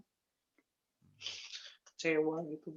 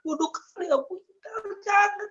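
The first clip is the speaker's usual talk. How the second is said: high, pleading outcry; retold cry; 13 semitones higher than usual. Calling to God over his own past sins, retold high cry. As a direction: plead helplessly in a raised pitch. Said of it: "Aku teriak tapi nggak didengar".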